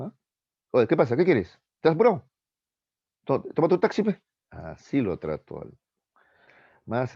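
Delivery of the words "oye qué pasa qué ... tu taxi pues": louder, rising higher with each question; cocky tough-guy challenge. Retold or imitated. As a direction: bristle at the passenger like a bus conductor spoiling for a fight. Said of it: "el cobrador se puso valentón".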